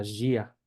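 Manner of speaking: Gia said as "ZHEE-a"; Portuguese pronunciation demo. Shown as a demonstration. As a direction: start Gia with a buzzing zh. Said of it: "Que sería".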